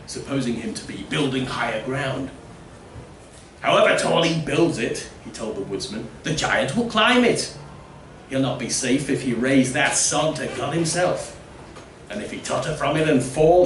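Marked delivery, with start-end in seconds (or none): 3.6-5.1 carpenter voice. Acted. Carpenter tells the woodsman. 6.2-13.6 mocking carpenter. Carpenter voiced in story.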